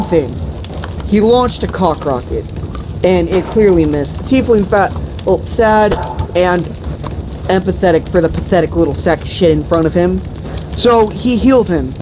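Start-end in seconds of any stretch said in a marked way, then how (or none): none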